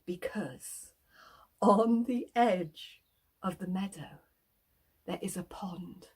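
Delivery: ominous hushed whisper. A narrator's reading. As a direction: drop to a secret breath. Suspense build.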